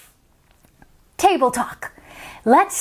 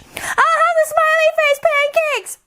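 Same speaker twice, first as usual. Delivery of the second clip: put-on much higher child yelling; calling out; 13 semitones higher than usual. Isabella yelling into the phone at Grandma. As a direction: yell it into the phone. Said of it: "loud order at diner".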